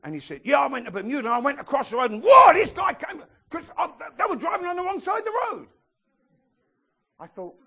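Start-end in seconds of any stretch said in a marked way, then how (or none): 0.4-5.7 loud, high-pitched comic outrage; indignant retold outburst. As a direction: build to a shocked, high yell. Retelling a man's indignant travel story.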